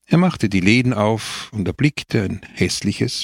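ordinary delivery

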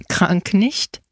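usual speaking voice

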